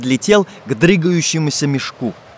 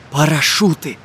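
plain reading voice

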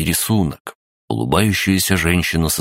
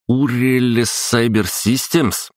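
higher, puzzled echo of a strange name; puzzled repeat; 7 semitones higher, slower than usual. Acted. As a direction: repeat it, baffled. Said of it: "Что это значит?". (недоумённый переспрос)

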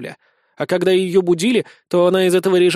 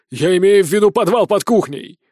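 mildly raised furious voice; enraged yelling; 3 dB louder than usual. A narrator's reading. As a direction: yell at the assistant, slightly held back. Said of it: "заорал в лицо помощнику разъярённый полковник". (разъярённо, умеренный крик)

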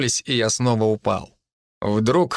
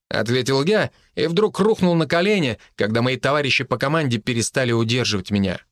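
ordinary delivery, nothing marked

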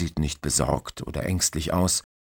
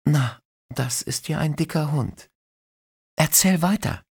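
put-on intrigued young woman; 11 semitones higher, 3 dB louder than usual. Christine voiced high.

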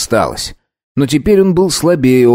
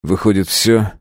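ordinary delivery, nothing marked

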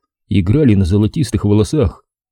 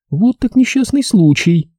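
mocking girl voice; 12 semitones higher than usual. A narrator's reading. Katie mocking, high.

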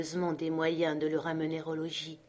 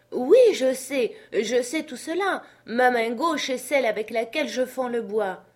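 insistent servant; 6 semitones higher, 5 dB louder than usual. Acted. Jupiter defends himself to his master, insistent. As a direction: insist that you do know.

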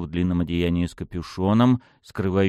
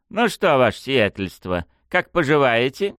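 high, sneering mock-politeness; 9 semitones higher than usual. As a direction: taunt her with sweet contempt. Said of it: "насмешливо осведомилась она".

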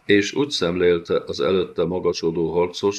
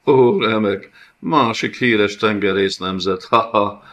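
louder and higher, a hammed-up cackle of scorn; mocking laugh; 4 semitones higher, 6 dB louder than usual. The karasz laughing at the red-bearded prisoner, loud and mocking. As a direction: jeer at the prisoner through laughter. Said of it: "hétrét görnyedt a nevetéstől".

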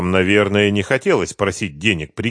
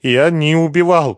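muttered denial; 8 semitones higher, 6 dB louder than usual. A narrator's reading. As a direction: mumble it plaintively, pitched up. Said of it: "пробормотал он".

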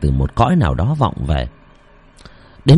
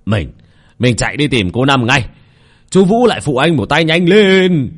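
shouting to the crowd; 5 semitones higher than usual. Acted. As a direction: call the men over, hurry them up. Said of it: "hét to". (hét to gấp gáp)

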